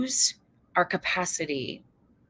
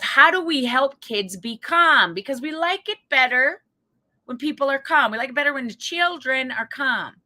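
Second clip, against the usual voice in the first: mock earnest psychologist; 7 semitones higher, 6 dB louder than usual. Mocking old psychologists' view.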